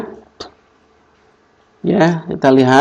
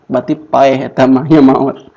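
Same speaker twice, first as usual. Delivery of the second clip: ordinary talk, nothing marked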